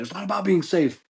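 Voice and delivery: high-pitched hammed-up outrage; wounded indignant rant. Dad defending his manhood to wife, mock anguished. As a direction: overwrought husband, voice pushed way up.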